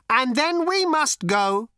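high, slow robot solemnity; deadly serious robot. A narrator's reading. Robot tells Zaphod its plans, utterly serious. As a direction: say it slowly, in all seriousness. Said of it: "said the robot in all seriousness".

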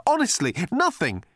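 high, pleading insistence; insisting Arthur. A narrator's reading. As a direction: beg to be believed. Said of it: "insisted Arthur".

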